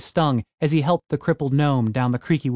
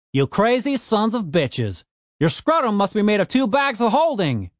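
a lightly voiced gruff dwarf, raised and fast; gruff Frank voice; 7 semitones higher than usual. A narrator's reading. Frank snapping at Tony the Elf, lightly raised gruff voice. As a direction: push back hard at the elf, brisk.

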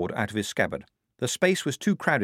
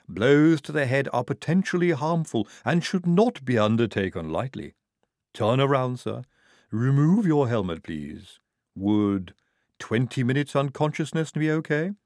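a precise, careful medical voice; clinical Igorina; 4 semitones higher than usual. A narrator's reading. Igorina politely preparing to knock out the guard, clinical and precise. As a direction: explain it like a polite surgeon.

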